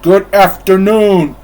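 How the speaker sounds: loud, high angry shout. Acted. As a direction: bellow him out the door. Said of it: "Scrooge shouted".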